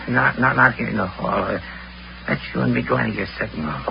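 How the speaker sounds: hushed. Acted. Secretive, wants privacy.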